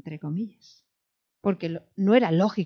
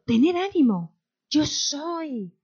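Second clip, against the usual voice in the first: raised, projected proclamation; ringing, higher declaration; commanding call; 7 semitones higher, 5 dB louder, much slower than usual. Acted. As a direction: call out to reassure them across the storm. Jesus calling to disciples over the sea.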